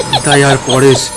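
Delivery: usual speaking voice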